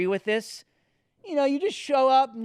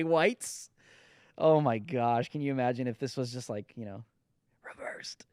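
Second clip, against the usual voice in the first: ordinary talk, nothing marked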